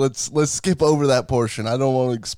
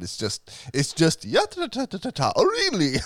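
mock exasperation, jumping much higher in pitch; imagined exasperated Irishman; 8 semitones higher than usual. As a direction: babble it in a high mock voice. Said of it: "some Irish guy's gonna be like".

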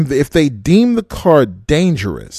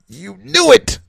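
accusing shout; 6 semitones higher, slower than usual. Real, not acted. Calling out a driver.

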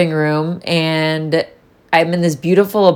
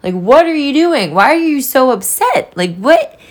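half-mock, exasperated self-scolding, climbing high; a lightly voiced self-rebuke, pitch jumping up; scolding herself; 6 semitones higher than usual. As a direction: tell yourself off in disbelief. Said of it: "I was like".

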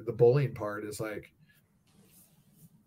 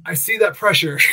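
laughing; 5 semitones higher, 4 dB louder than usual. Retold or imitated. Partner laughs after the roll.

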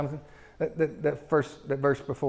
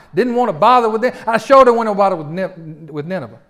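going off; 4 semitones higher, 8 dB louder than usual. Acted. Jonah complaining to God about his mission, indignant rant. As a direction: launch into a self-justifying grievance at God. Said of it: "So he just goes off. He clucks off".

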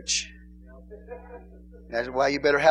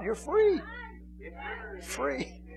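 high teasing "free"; 10 semitones higher, 10 dB quieter, much slower than usual. Acted. Preacher congratulating singles, high.

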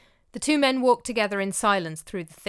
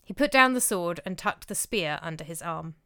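ordinary delivery

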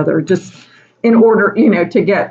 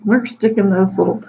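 usual speaking voice

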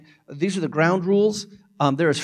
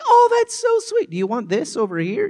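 put-on very high, sweet baby talk; loving parent; 13 semitones higher, 5 dB louder than usual. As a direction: coo tenderly at a toddler. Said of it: "as a loving parent".

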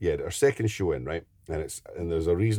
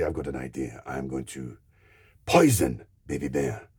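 pantomime villain; 5 semitones lower than usual. Retold or imitated. Villain announcing his plan to poison Baby Bear, low scheming voice. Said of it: "I was the villain".

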